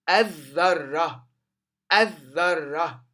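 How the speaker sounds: "الذرة" loud, with a strongly doubled ذ; shadda on dhal. Shown as a demonstration. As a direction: teach "الذرة" as adh-dharra, lam silent. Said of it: "وشدة فوق الذال". ("الذرة" بشدة واضحة على الذال)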